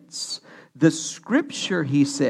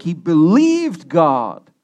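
"believed" stretched slow and louder; stress on BELIEVED; 6 dB louder, slower than usual. Shown as a demonstration. Preacher returning to Abraham's faith, strong emphasis on "believed". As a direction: hit "believed" hard within the slow phrase. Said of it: "Emphasis on the word belief".

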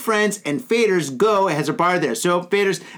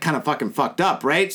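usual speaking voice

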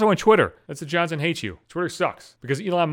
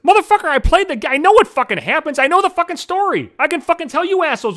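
loud, high shout; louder and higher, outraged; angry outburst; 10 semitones higher, 4 dB louder than usual. Real, not acted. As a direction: yell it back at them, fed up. Angry retort to spoiler warning.

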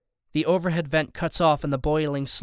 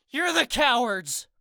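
defiant yell; 9 semitones higher, slower than usual. A narrator's reading. Hero yells defiance at villains.

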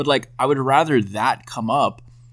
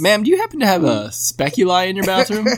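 salesman pitch; 8 semitones higher than usual. Retold or imitated. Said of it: "You'd walk up to you".

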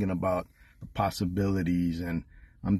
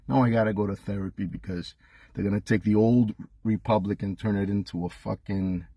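normal talking voice

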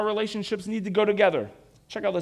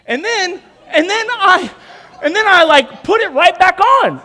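loud, higher, laughing through the words; 10 semitones higher, 11 dB louder than usual. Real, not acted. Preacher laughing at his own blunt dating story, loud laughter. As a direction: crack up at yourself.

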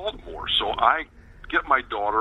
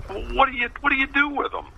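high-pitched imitation of a puzzled writer; mock amazed questioning; 13 semitones higher, faster than usual. Writer asking about the armadillo herd, mock amazed. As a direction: stumble into the question, lightly mimicking his bafflement.

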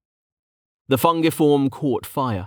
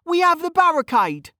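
put-on high-pitched arguing young mage; insistent protest. Mage defending himself to his rival, insistent. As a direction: argue back in a high voice. Kettle argues with Vink.

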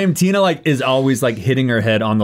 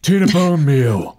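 slow, drawn-out name in a put-on voice; Tina in character; 3 semitones higher, slower than usual. Tina tells Boz what Tina stands for, slow character voice. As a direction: stretch the name out slowly. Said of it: "let Boz know what Tina stands for".

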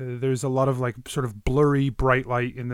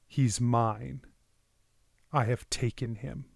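ominous dream voice; 6 dB quieter than usual. Acted. Sinister voice speaks in dream.

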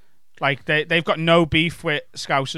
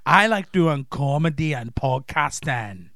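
very slow, drawn-out Glaswegian introduction; thick Glaswegian accent; much slower than usual. Pretend. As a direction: lay the Glaswegian on thick, slowly. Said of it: "like world travel talking to you".

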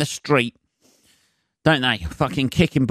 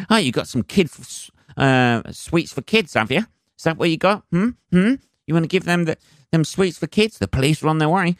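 mock accusing geezer; 7 semitones higher than usual. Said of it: "on my doorstep going".

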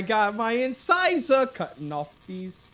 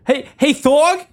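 loud, high call to someone nearby; 8 semitones higher, 11 dB louder than usual. Acted. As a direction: get his attention from across the room. Calling out to Thorg.

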